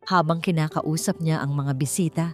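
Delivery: plain reading voice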